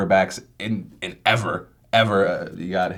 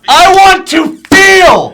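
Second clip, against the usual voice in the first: high, loud, slow melodramatic cry; overdramatic shout; 16 dB louder, much slower than usual. Pretend. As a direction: wail it like a soap opera. Mock dramatic outburst.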